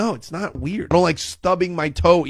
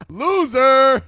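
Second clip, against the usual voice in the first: louder sneering "Loser."; bully taunt; 5 semitones higher, 9 dB louder than usual. Acted. A bully to a tucked-in kid, loud sneering taunt. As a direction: spit it out like a schoolyard bully.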